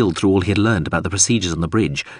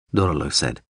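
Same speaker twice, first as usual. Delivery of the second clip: usual speaking voice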